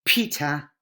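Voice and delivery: Peter with a strong aspirated P; exaggerated English P. Shown as a demonstration. Teacher demos the English P for Spanish speakers, aspirated. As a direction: puff air hard on the P of Peter. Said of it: "I'm exaggerating".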